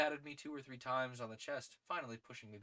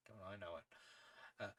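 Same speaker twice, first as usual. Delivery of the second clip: usual speaking voice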